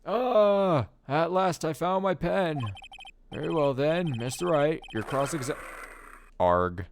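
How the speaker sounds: put-on bumbling old judge presiding.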